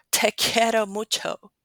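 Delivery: Spanish with English-style puffs of air on t and k; aspirated Spanish. Shown as a demonstration. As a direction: aspirate Te and quiero like US-influenced Latin American Spanish. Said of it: "That is aspiration in Spanish".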